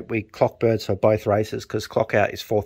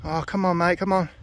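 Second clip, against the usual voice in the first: higher, rapid coaxing; eager urging; 9 semitones higher than usual. Real, not acted. Fancier coaxing his bird down, eager and high. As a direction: coax the bird in, impatient.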